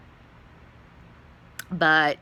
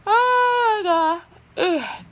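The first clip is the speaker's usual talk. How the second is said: pained cry; 4 dB louder, much slower than usual. Real, not acted. Sudden pain or shock mid-story.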